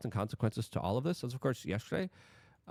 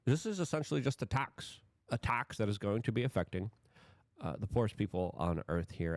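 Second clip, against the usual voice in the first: ordinary talk, nothing marked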